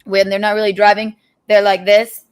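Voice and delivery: ordinary talk, nothing marked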